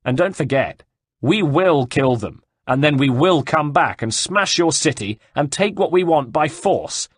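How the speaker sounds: threatening shout. A narrator's reading. Cecco gives Caterina an hour before riding off, threatening shout. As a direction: hammer the threats home across the distance.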